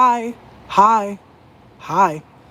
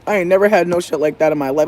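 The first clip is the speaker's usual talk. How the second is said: ordinary talk, nothing marked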